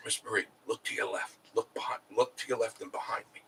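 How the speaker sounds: softly retelling a whisper to a friend in a store; retold whisper. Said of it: "I whispered to Miss Marie".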